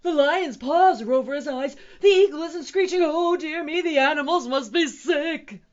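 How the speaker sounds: high, loud panicked keeper; frantic zookeeper's lament; wailing. A narrator's reading. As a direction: wail it in mounting alarm. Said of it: "he wailed".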